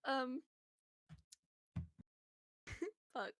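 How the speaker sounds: soft giggly apology; tipsy laughing whisper of sorry; real giggles. Real, not acted. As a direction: giggle helplessly under the words. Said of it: "I'm really giggly".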